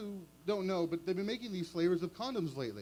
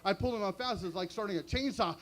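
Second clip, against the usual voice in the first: usual speaking voice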